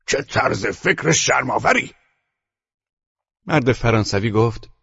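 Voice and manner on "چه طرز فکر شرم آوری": understated cry of revulsion; disgusted shout. A narrator's reading. The general scolding the narrator, a mild disgusted shout. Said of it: "ژنرال با نفرت فریاد زد".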